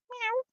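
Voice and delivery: small, high-pitched meow; soft little imitated cat mew. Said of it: "It's my cat impression".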